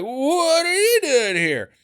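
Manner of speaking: high, slow startled question; mock surprise. Family catching the lovers, high and slow surprise. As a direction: draw it out high in fake shock. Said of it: "they're like".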